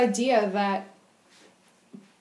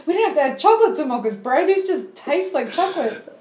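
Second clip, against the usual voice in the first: mock proud barista; 6 semitones higher, 5 dB louder than usual. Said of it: "You just sat there and went".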